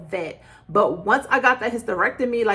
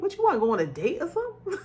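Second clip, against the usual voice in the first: teasing laugh; 9 semitones higher, much faster than usual. Retold or imitated.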